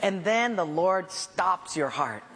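normal talking voice

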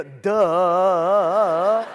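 loud, long-held dopey duh; drawn-out, booming dumb-guy voice; mocking duh. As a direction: blare it out like it's painfully obvious. Voicing anyone seeing the obvious.

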